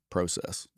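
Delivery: "process" with a long o, first syllable like "pro"; Canadian pronunciation. Shown as a demonstration. Joking about a tariff, "process" with a Canadian long o. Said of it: "or process if you're in Canada".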